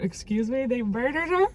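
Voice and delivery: incredulous rising question; mock outrage at the news; shocked disbelief. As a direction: react in stunned disbelief. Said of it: "I was just like".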